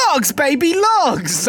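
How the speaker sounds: high, loud Austin Powers lumberjack voice. Retold or imitated. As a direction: belt it like a groovy lumberjack. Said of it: "if Austin Powers was a lumberjack".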